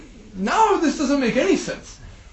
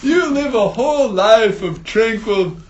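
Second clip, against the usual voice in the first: louder and higher, half laughing, half crying; envious fish blurting to its neighbour, loud and laughing; excited; 4 semitones higher, 5 dB louder than usual. Acted. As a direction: gush with laughing envy. Rui fish's tearful, laughing outburst.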